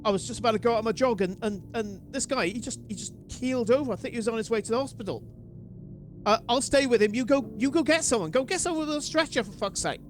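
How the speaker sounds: high, urgent panicked passer-by; frantic bystander. Acted. Con artist playing a shaken jogger, high and urgent. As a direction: rattle it off, pretending to be shocked.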